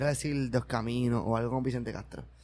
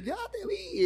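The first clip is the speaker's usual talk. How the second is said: mock gushing owner; 7 dB quieter, slower than usual.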